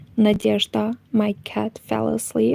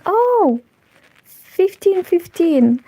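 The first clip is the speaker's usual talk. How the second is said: surprised; 8 semitones higher, 3 dB louder, slower than usual. Real, not acted. Notices clock reads 15:15.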